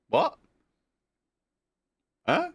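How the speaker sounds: a sudden high, startled what; surprised. Real, not acted. Streamer startled by the chat, high surprised yelp. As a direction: jump up in pitch, caught off guard.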